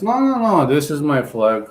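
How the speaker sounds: ordinary talk, nothing marked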